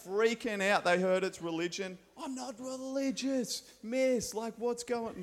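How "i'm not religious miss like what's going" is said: put-on high-pitched kid protesting to the teacher; mock-anxious student telling the teacher she's not religious; worried kid. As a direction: squeak it like an anxious twelve-year-old. Kids freaking out about religion.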